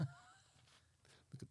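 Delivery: slow whispered jab; teasing whisper. Real, not acted. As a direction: whisper it slowly like a secret tease.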